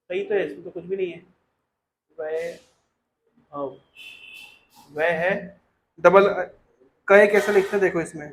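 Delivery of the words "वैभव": वैभव stretched very slowly, long वै, pause, then भव; वैभव pulled apart for spelling; syllable by syllable. Shown as a demonstration. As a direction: dictate वैभव softly in separated pieces. Teacher sounding out a name.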